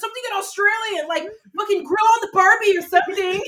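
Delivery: loud, high, hammed-up demand; mom's comeback. Friend quoting a mom telling the guy what to say, loud comeback. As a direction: imitate a mom firing back, high and loud. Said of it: "She was like".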